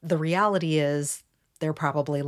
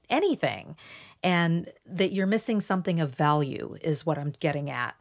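ordinary talk, nothing marked

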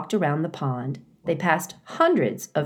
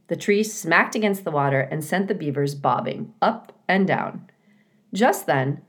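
ordinary delivery

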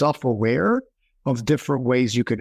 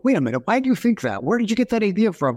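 higher, indignant demanding questions; replaying how defensive you got; retold challenge, pitched way up; mock belligerent; 9 semitones higher than usual. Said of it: "all of a sudden I became belligerent".

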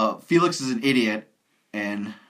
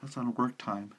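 hushed; 10 dB quieter than usual. Real, not acted. Joking about maintenance guy.

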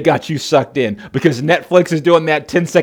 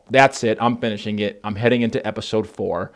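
quiet resolve; 7 semitones lower, 6 dB quieter than usual. Retold or imitated.

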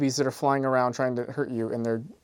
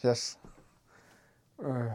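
put-on slow, slurred drunk talk. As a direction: pretend to be drunk and woozy. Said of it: "I'm so drunk right now".

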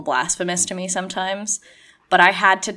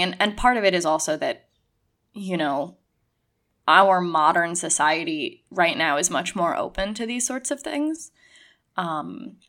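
normal talking voice

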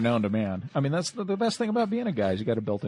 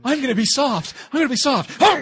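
high, loud, sweet-talking cop; chirpy good cop voice; 13 semitones higher, 5 dB louder than usual. Pretend.

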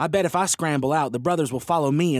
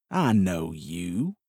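shit-eating grin; 6 semitones lower, much slower than usual. Acted. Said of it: "with a shit-eating grin".

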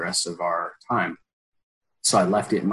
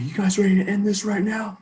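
high, fired-up rallying call; hype call; 11 semitones higher than usual. Retold or imitated. To the team in the huddle, a high hype shout. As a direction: pump up the huddle.

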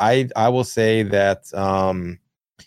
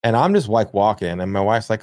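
normal talking voice

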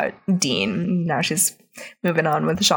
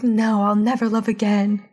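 mock devastated. Little girl swears off love, mock devastated. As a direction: wail it lightly, hammed-up heartbreak.

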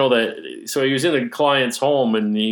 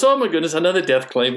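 higher, louder English accent imitation; playful English accent, raised pitch; mock English accent; 7 semitones higher, 4 dB louder, faster than usual. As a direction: ham up the English accent for the punchline. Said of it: "he had that English accent".

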